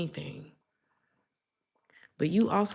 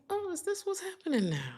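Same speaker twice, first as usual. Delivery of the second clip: high, soft voice of surprise; softly pitched up, acting newly bewildered; mock astonishment; 13 semitones higher, 5 dB quieter than usual. Feeling old, reacting to things seen online, mock surprised.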